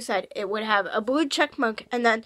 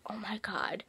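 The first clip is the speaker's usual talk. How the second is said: awed whisper; 4 semitones lower, 16 dB quieter than usual. Real, not acted. Awed aside.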